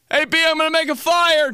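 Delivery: high, excited holler as dad; imitated dad calling out. Dad announcing a fire to kid, imitated high holler. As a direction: holler it proudly across the house.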